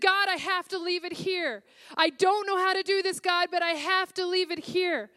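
high, loud, pleading prayer through retold sobs; a reliving of weeping at the window, high and loud; retold sobbing prayer. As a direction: cry out to God as if sobbing again. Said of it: "I just started sobbing".

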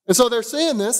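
ordinary delivery

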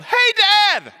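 loud high holler to Dad; child's yell. Acted. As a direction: yell it like a kid mid-jump. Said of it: "and then yelled".

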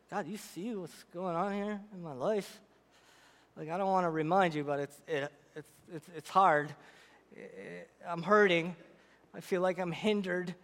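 low, hushed, halting prayer; hurting prayer. Acted. Hurting believer asking God about their life, quiet and halting. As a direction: pray it softly, stumbling over the words.

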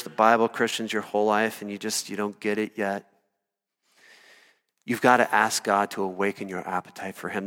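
ordinary talk, nothing marked

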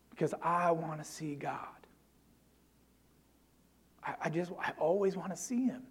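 breathy, intimate whisper of longing; hushed whisper. Retold or imitated. Pastor quietly confesses his wish to see God, whispering. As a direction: let it fall to a whisper, as if confessing.

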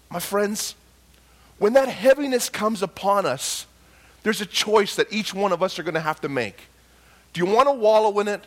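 ordinary talk

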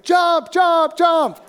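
high, loud mock calls from below; shouted urging. Friends urging a stranded climber to jump, shouted. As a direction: yell it up to someone on a rock.

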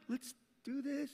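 usual speaking voice

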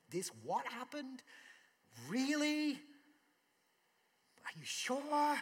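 high, stunned disbelief; amazed bystanders. Retold or imitated. Onlookers at the tomb reacting to the miracle, astonished and high. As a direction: gasp it out like you can't believe your eyes. Crowd reacting to Lazarus raised.

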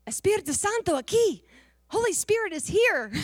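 high, loud cry imitating her announcement; excited proclamation. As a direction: call it out like a joyful revelation. Retold woman's awed announcement on waking.

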